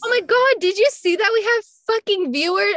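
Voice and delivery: high, loud excited burst. Real, not acted. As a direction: gush with delight. Excited at viewer count.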